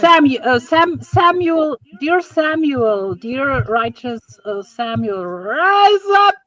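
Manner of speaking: drawn-out, slow calling of a name; slow summoning call. Pretend. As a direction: call him up slowly like a ritual. Summoning Samuel to panel.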